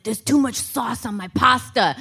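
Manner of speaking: put-on dad grumbling at a restaurant; mock a fussy father's complaint; imitated gripe to the waiter; complaining dad. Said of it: "he'll just complain at every restaurant".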